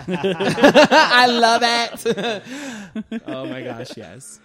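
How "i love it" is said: loud, high, drawn-out laughing cry; gleeful holler through laughter; laughing delight. Real, not acted. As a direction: burst out laughing and stretch the words.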